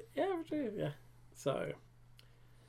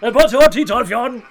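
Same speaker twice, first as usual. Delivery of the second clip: much louder, shouted straight into her face; said right in her face; 6 semitones higher, 13 dB louder than usual. Retold or imitated. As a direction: bellow the list into her face. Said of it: "Det siger lige i hovedet på hende".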